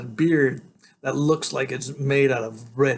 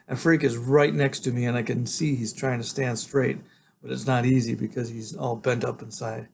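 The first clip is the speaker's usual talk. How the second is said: ordinary delivery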